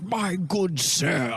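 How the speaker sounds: put-on dad putting on a posh British accent; fake posh British. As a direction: do a pompous posh British greeting, drawn out. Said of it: "fake posh British accent".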